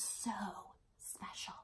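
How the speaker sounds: soft unvoiced whisper. A narrator's reading. Storyteller ending the moment in a whisper. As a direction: breathe the word like a secret.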